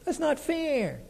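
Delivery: put-on high, soft childish protest; mock kid voice, high and quiet; complaining kid. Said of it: "How many times did you say it?".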